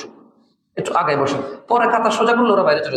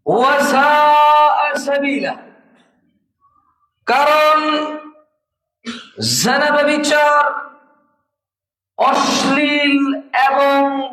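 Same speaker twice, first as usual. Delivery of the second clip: full-force preaching; 8 semitones higher, slower than usual. Acted. Preacher warning the Friday congregation against love, full-force preaching. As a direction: preach it to the rafters, stretching each phrase.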